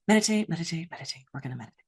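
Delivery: hushed self-coaching chant; inner dialogue. Acted. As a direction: repeat it softly to yourself, fading almost to nothing. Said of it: "This would be my inner dialogue".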